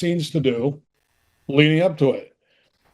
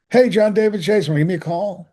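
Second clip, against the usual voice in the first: higher-pitched, upbeat phone message; 6 semitones higher than usual. Retold or imitated. Boss on the answering machine, upbeat. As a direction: imitate a cheery caller leaving a message. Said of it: "He sounded so upbeat".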